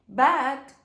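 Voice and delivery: loud laugh breaking through the word. Real, not acted. Vlogger laughing at her own makeup mishap, loud laugh. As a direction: crack up mid-word, slowly.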